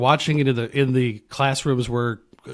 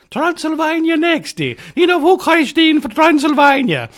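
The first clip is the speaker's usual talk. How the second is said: high, loud train conductor's call; ticket taker; 8 dB louder than usual. Acted. Ticket taker calling to train passengers, loud conductor's cry. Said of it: "the ticket taker".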